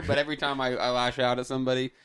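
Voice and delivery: ordinary talk, nothing marked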